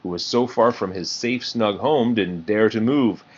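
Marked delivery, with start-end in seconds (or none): none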